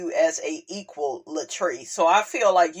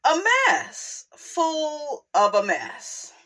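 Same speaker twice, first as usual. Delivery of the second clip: squealed verdict; 6 semitones higher, slower than usual. Real, not acted.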